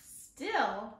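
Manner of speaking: ordinary delivery, nothing marked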